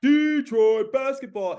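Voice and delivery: loud, high, drawn-out arena chant; big stretched-out hometown announcer call; cartoonish PA announcer. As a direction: belt it out to the whole arena. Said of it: "treat everything sort of like a cartoon".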